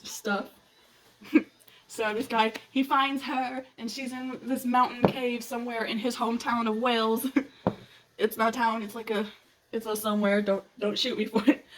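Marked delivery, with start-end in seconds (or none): none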